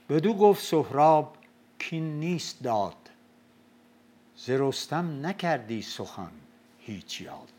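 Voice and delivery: slow, loud Shahnameh chant; epic verse recital. Acted. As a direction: declaim it like an old epic.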